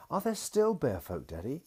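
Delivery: put-on high, eager child asking; a small girl's bright question; little girl voice. A narrator's reading. Daughter asks her daddy in a high, eager little voice.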